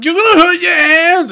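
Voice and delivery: high alarmed cry; worried shout. Retold or imitated. Gabriel worried cry in retelling.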